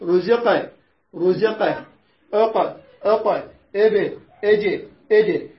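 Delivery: قاف in رزق and أقل said with a bouncing echo; qalqala demo. Shown as a demonstration. Teacher showing the class the qalqala echo on رزق. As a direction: bounce the final ق of رزق clearly. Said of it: "القلقلة تقول".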